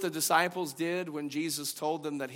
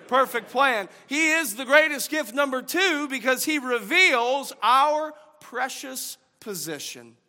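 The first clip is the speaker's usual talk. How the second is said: loud, high preaching voice; fervent sermon delivery; 7 semitones higher, 9 dB louder than usual. Real, not acted. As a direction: preach it out to the church. Preaching at full force again.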